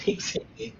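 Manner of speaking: high breathy laugh through the word. Real, not acted. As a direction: laugh it out, amused by the joke. Teacher laughs at student comment. (riendo a carcajadas en voz alta)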